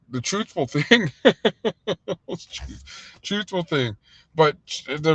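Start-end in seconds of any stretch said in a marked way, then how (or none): 1.2-2.7 real high laugh. Real, not acted. Speaker amused by the truthful thing, laughing. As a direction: burst into a run of high chuckles. Amused at his own phrase.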